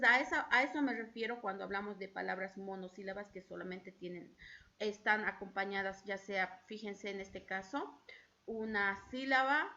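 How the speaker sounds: ordinary talk, nothing marked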